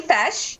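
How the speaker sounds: "fesh" with a short E and SH ending; correct Irish pronunciation. Shown as a demonstration. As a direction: say "fesh" to rhyme with "mesh". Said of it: "So we pronounce them fesh".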